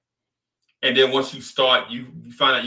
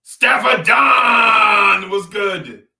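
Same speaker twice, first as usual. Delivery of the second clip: slow, drawn-out taunting yell, much louder and higher; mocking shout; 8 semitones higher, 9 dB louder, much slower than usual. Knicks fan gloating at Boston fans, slow mocking shout. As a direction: stretch it out like a victory chant.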